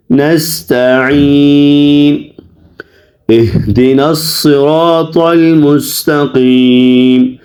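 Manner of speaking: slow, measured recitation with drawn-out vowels; Quran recitation. Shown as a demonstration. Teacher models the verse for students, slow recitation. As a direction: recite slowly and evenly, stretching long vowels.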